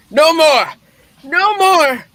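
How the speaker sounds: frantic shouting plea; anguished cry. Acted. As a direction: cry out in desperate refusal. Scrooge refusing further visions.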